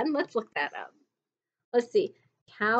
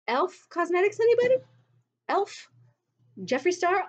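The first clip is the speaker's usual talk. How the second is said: mock the obviousness, voice rising high; sarcastic anybody; 7 semitones higher than usual. Real, not acted. Vlogger needling brands over red lipstick, sarcastic. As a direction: pitch shooting up high on anybody.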